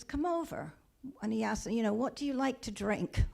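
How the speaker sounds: high, soft friendly invitation; old man softly coaxing the drunk closer. Retold or imitated. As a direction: lure him in kindly with a lilt.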